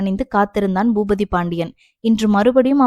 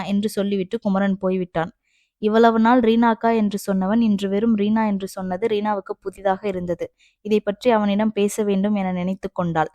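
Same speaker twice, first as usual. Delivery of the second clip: plain reading voice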